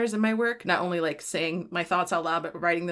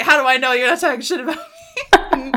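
mom; 7 semitones higher, 11 dB louder, faster than usual. Retold or imitated. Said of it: "so she's like".

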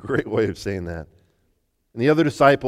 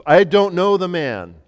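loud, hot denial, slower on the second line; angry denial; 7 semitones higher, 7 dB louder, slower than usual. Acted. Peter denying Jesus to the crowd, angry and loud. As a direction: Peter swearing he never knew Jesus. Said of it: "I started getting angry".

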